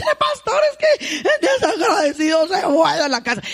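hammed-up aggrieved mother; complaining mother. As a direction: whine about the ungrateful son.

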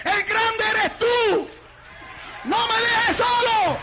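high, drawn-out pleading cry to God; desperate prayer. Acted. (súplica desesperada, aguda y alargada)

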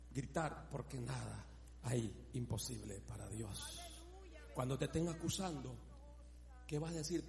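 low, soft, intimate repetition; quiet low sermon voice; hushed declaration. Acted. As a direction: murmur the promise like a prayer.

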